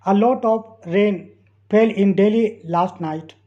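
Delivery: very slow, in two chunks; slow dictation. Shown as a demonstration. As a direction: read it slowly for learners to follow. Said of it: "teacher reads sentence slowly".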